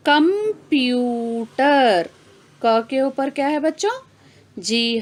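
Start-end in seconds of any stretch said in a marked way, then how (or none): 0.1-2.1 computer said slowly, every syllable separate; slow demo word. Shown as a demonstration. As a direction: model computer slowly for the class. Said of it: "अगला शब्द है". (धीमा, साफ़ उच्चारण)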